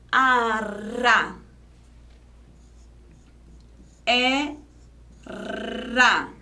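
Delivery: rolled tongue-tip R in "Arra"; trembling R. Shown as a demonstration. Teacher showing a lone R between vowels, trembling R. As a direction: hold a long trill before "ra". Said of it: "ele treme".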